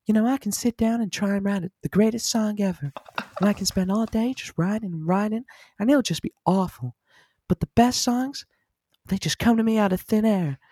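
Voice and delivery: soft high celebrity impression. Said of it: "well-renowned paedophile ... the perfect words".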